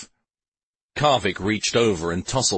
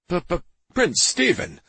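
higher-voiced stammered address; stammering; 10 semitones higher than usual. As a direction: stutter on the first sound of the title. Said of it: "the Lord Mayor stammered".

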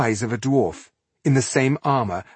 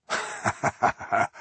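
pleasant laugh. Acted. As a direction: laugh lightly and calmly. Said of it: "The stranger laughed, pleasantly now".

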